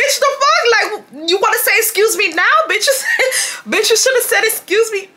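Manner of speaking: high, laughing re-enacted outrage; mock outrage breaking into laughs; incredulous. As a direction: argue back in high-pitched disbelief. Acted quarrel, own incredulous comeback.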